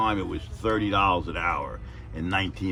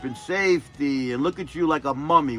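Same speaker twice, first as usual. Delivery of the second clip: much higher, loud public telling-off; mock scolding boss; 9 semitones higher, 7 dB louder than usual. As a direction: roast him in front of everyone.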